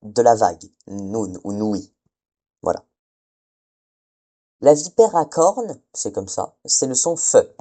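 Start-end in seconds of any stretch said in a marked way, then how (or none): 0.9-1.9 "nun ou noui" stretched out very slowly, softer; drawn-out sounding. Shown as a demonstration. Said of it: "retranscrire avec le son ne". (« nun ou noui » étiré, très lentement)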